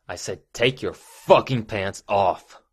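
loud, slow, emphatic command; forceful order. Acted. Boss repeating an ignored order, loud and slow.

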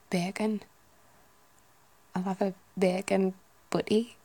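Geordie lilt, rising at the end; Newcastle accent. Shown as a demonstration. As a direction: order like a Newcastle local. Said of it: "like Newcastle".

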